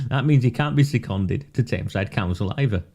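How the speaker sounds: usual speaking voice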